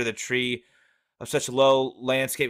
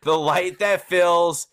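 slow, louder verse recital; raised, drawn-out poetry voice; poem reading; 4 semitones higher, 5 dB louder, slower than usual. Acted. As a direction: declaim the line of poetry slowly. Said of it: "in my best poem reading voice".

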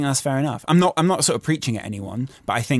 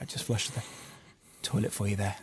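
pointed aside; 5 semitones lower, 13 dB quieter than usual. Retold or imitated. Pointedly announcing the flush.

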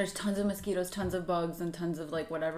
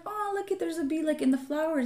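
bright, much higher sing-song voice; light and sweet, as if to a small child; cheerful pointing out; 9 semitones higher than usual. Retold or imitated. Mother cheerfully points out a bee to her daughter, high sing-song. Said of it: "So I was just like".

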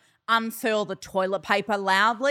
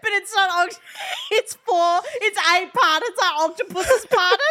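high, cracking through tears of laughter; can barely get words out laughing; laughing hysterically; 13 semitones higher, 3 dB louder than usual. Real, not acted. Helpless laughter.

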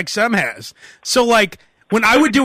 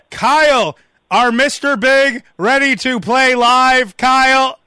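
slow, higher, projected studio call; fake radio call-out; 4 semitones higher, 4 dB louder, slower than usual. Host pretending the band is live, calling to Kyle. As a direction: call through the glass, stretching the question. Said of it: "like Kyle's some engineer behind the glass".

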